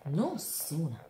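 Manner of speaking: usual speaking voice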